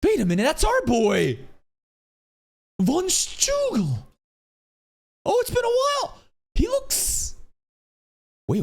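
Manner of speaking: high-pitched delighted exclaiming; real fan excitement, voice shooting up; excited recognition. Real, not acted. Recognizing a returning character on screen.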